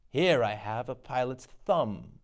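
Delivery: usual speaking voice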